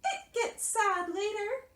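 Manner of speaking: high-pitched hopeful mouse; Mouse promising. A narrator's reading. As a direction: reassure in a tiny mouse voice. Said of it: "Mouse promised".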